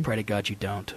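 very low, soft warning; low mock threat. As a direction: drop it deep and quiet, mock-ominous. Deadpan mock threat, then repeated slower.

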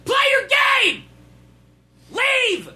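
mock yelling. Yelling at chat viewer.

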